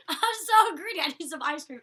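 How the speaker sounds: high, loud laughing squeal; playful greedy outburst. Real, not acted. Vlogger confessing a sudden ice cream craving, loud playful squeal. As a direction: burst out with a giddy, self-mocking craving.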